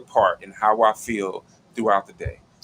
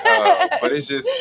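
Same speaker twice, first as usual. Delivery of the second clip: much higher, louder laughing burst; 7 dB louder than usual. Real, not acted. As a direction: break into laughter mid-sentence.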